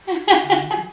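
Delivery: short laugh. Real, not acted. Laughs at not being able to answer.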